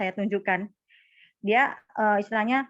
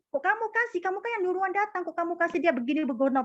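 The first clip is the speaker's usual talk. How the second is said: imagined indignant scolding; 8 semitones higher than usual. Retold or imitated. Agent imagined griping about a customer given away, mock indignant. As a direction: imitate a huffy colleague's rapid, high complaint. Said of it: "ya gini, sewot. Udah duduk nggak bisa, kebakaran jenggot".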